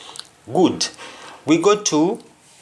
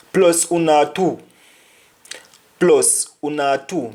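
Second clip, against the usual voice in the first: Nigerian pidgin quote; 3 semitones higher than usual. Retold or imitated. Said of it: "[name] says in Nigerian".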